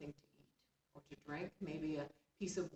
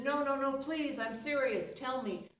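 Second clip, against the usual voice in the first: louder, higher, urgent begging; pleading fish; 7 semitones higher, 6 dB louder than usual. Acted. Fish begs frightened Nomi to stay, urgently pleading. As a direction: stop her from running away.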